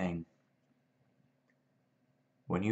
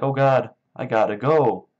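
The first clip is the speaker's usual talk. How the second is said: loud, raised panicked exclamation; mock panic; 5 semitones higher, 8 dB louder than usual. Lenny flees after killing her, in loud mock panic. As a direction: imitate someone panicking to get away. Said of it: "he panics".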